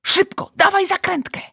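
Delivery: high, loud, hurried demand; urgent command. Acted. Giant urgently demands the lid.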